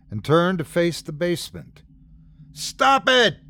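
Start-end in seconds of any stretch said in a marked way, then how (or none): 2.5-3.4 loud, high-pitched sudden shout. A narrator's reading. Warren yells at the sound from the basement, shouting. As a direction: shout it out at the top of the voice. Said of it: "he shouted".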